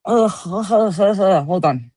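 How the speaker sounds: very slow, strained coughing laugh. Real, not acted. Streamer asking chat to wait, coughing and laughing. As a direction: fight through the cough. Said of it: "It went down the wrong pipe".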